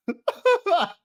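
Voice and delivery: high, loud laugh bursting out; burst of laughter. Real, not acted. Host laughing at his chat's crazy comments, high and loud. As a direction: crack up at the chat.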